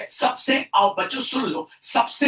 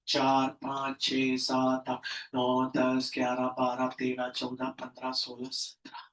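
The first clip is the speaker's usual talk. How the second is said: low, soft monotone count; murmured tally, fading towards a whisper; counting under breath; 11 semitones lower, 9 dB quieter than usual. Real, not acted. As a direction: count off quietly to yourself. Counting while drawing protons.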